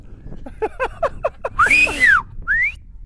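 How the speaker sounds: high, loud finger whistle; herding whistle. Shown as a demonstration. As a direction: whistle sharply to the dog. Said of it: "I'll be like this".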